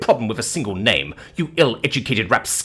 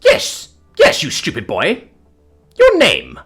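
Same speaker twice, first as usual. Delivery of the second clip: high, loud, impatient demand; imperious outburst of irritation; exasperated; 6 semitones higher, 6 dB louder than usual. Acted. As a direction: snap at him like a haughty dame. Dame impatiently demands his name.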